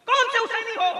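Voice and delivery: high-pitched, ringing question; challenging cry. Real, not acted. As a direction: demand an answer from the congregation. Preacher challenges the congregation.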